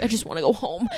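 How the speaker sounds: soft, mock-pitiful plea; retold sadness. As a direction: lightly whine it, softly. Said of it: "like, so sad".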